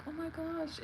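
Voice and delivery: high, soft fake gasp of concern; mock dismay. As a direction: get under her skin with fake sympathy. Needling roommate on purpose.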